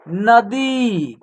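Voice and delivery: नदी very slowly, final ee held; long vowel. Shown as a demonstration. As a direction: say नदी as one word, stretching the ī. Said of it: "खींच करके होगा".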